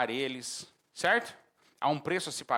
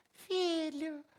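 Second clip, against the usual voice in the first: high, soft cooing call; exaggerated sweet-parent falsetto; mock-gentle parent; 11 semitones higher, 6 dB quieter than usual. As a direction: coo softly to your child, hammed up. Soft parent, contrast with shouting.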